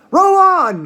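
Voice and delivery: Mister Wonka shouting to the rowers. A narrator's reading. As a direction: bellow the order to the rowers. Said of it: "shouted Mister Wonka".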